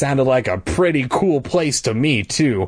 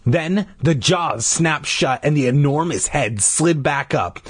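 usual speaking voice

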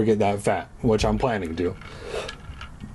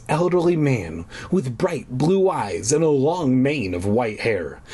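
normal talking voice

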